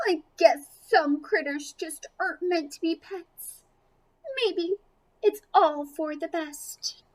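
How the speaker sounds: sadly miss the pet; Lucy's sad goodbye. Acted. Lucy walking home after leaving Squeaker, sad and tearful. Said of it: "Lucy had a lot to think about".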